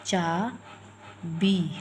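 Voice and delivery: चाबी very slowly, both vowels held long; stressed long vowels. Shown as a demonstration. Teacher giving an example of long ee, stressed long vowels. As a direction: split चाबी into two drawn-out syllables. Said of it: "किसी बात पर stress देके".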